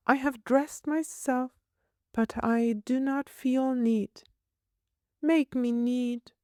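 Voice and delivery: put-on high, prim little girl asking. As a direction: voice a fussy small girl, pitched high. Child Paulina voiced throughout.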